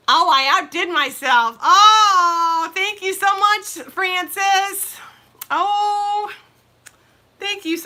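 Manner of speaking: high, loud gushing thanks near tears; overjoyed. Real, not acted. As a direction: gush with tearful joy. Thanking a viewer, half crying.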